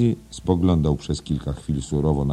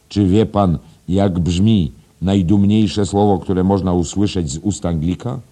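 raised, loud, rhetorical questioning; lecturing schoolmaster; 5 semitones higher, 9 dB louder than usual. Acted. Headmaster quizzing teacher on English pride, loud rhetorical lecture. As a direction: quiz him grandly, louder and higher. Voices Mr Deasy in story.

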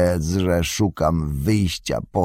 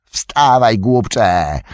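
louder barked command at a servant; shouting; 4 semitones higher, 7 dB louder, much slower than usual. A narrator's reading. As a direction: snap him to his feet. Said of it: "krzyknął na drugiego żebraka".

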